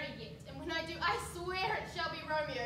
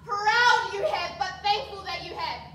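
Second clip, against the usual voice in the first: defiant outcry; 3 semitones higher, 11 dB louder than usual. Acted. Daughter defies father.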